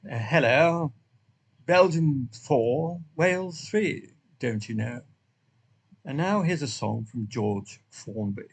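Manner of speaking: put-on slow, stiff old-fashioned English butler. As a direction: announce it like a stuffy butler. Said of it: "I can change it to an Englishman, but he sounds like the butler".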